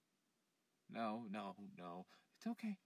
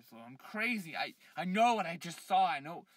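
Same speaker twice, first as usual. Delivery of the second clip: loud and higher, heated; defensive outburst; 6 semitones higher, 17 dB louder than usual. Acted. Man loudly defending himself to his friends. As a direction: snap back that you know what you saw.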